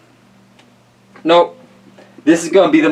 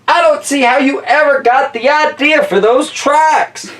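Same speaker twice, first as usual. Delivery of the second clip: much higher, gushing politician; excited mayor voice; 12 semitones higher than usual. Acted. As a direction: marvel like a thrilled mayor. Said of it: "That's my mayor voice, 'cause he's all excited".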